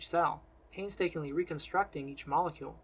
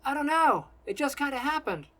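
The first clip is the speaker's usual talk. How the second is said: high, raised-voice excuse; defensive calling; 9 semitones higher, 5 dB louder than usual. A narrator's reading. Selden answers his angry friend in flight, defensive calling. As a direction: call back defensively, pitched up. Shouted quarrel while flying.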